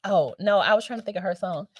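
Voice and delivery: ordinary talk, nothing marked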